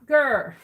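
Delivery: short loud frustrated growl; playful growl. Real, not acted. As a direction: growl out loud at the lost glue. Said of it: "Excuse me while I growl".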